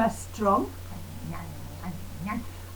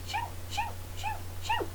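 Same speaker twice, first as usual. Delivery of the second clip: very high, soft shoo shoo; 9 dB quieter, much faster than usual. Shown as a demonstration. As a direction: shoo it away in a tiny high voice. Shooing in mouse voice.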